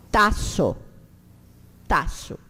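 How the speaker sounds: TAÇO with a hissing voiceless S in the middle; S as cedilla. Shown as a demonstration. Teacher reads the Esperanto word, sharp S in TAÇO. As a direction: keep the middle of TAÇO unvoiced, ta-so. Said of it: "tem aquele som desse cedilha".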